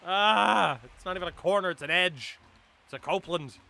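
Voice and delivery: loud, high frustrated yelling; angry outburst. Real, not acted. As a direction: rage at the bad call. Furious at the umpire's call.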